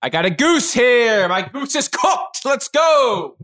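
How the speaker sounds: brash New York cook heading out; New York accent. Acted. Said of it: "Is that a New York accent?".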